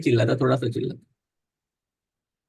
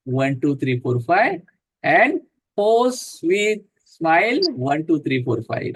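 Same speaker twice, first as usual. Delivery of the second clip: slow, lower counting for each breath; guided breathing count; 3 semitones lower, much slower than usual. Acted. Yoga teacher counting breaths for the class, calm and steady. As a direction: count the breath out gently, unhurried.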